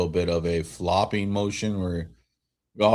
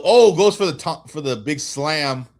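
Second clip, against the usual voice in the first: sudden jump, much higher and louder on the drive; excited call; 7 semitones higher, 6 dB louder than usual. Real, not acted. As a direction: erupt as he heads for the slam. Calling a big drive live.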